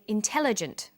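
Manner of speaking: "intelligent" with the stress on "tel", the other vowels short; model pronunciation. Shown as a demonstration. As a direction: say "intelligent" clearly, stress on the second syllable. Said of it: "we say".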